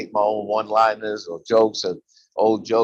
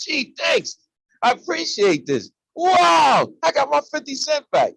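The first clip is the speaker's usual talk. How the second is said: much higher, bursting into a joyful "Wow"; a delighted kid getting his money back; excited little boy; slower than usual. Pretend. Little boy thanking the kind man, high and excited.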